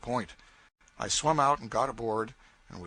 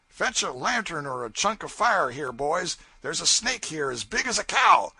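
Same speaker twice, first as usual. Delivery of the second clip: louder raftsman call about a snake; calling out alarmed; 5 semitones higher, 5 dB louder than usual. Acted. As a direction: call the boys over, alarmed. Child calls the crew over.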